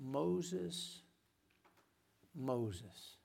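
a touch of an awed, tender divine call; intimate call. Acted. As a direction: call him gently from the burning bush. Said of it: "suddenly he hears these words".

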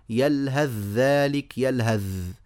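يلهث ذلك as "yalhadh-dhalik", then يلهث held on dh; الثاء merged into الذال. Shown as a demonstration. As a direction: go straight from h into a long dh in يلهث. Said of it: "من الهاء إلى الذال مباشرة". (نطق "يلهث" بذال مدغمة في "ذلك")

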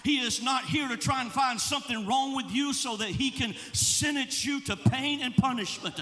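fervent sermon climax; full-force preaching. Acted. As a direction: build the congregation up. Preaching over music, building.

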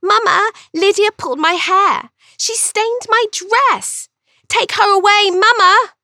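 put-on high, loud, whiny tattling girl; whining little sister. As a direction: wail to Mama like a crybaby sister telling tales. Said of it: "She would begin to cry and whine to our mother".

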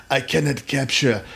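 hammed-up, badly faked French accent. Host mocking a pretentious art house film, bad French accent. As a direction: put on a poor French accent. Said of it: "With the bad French accent".

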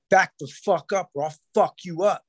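retelling a crowbar threat, mock menacing; retold threat. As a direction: menace the guy in a mock tough voice.

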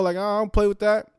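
normal talking voice

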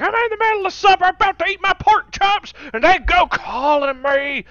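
very high, loud, whiny voice; whiny complainer. Acted. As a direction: whine like an outraged boomer. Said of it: "It's like".

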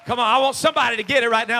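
loud, urgent exhortation; full-force preaching. Real, not acted. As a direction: fire up the congregation.

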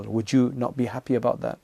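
ordinary delivery

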